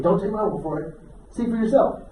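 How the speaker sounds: ordinary delivery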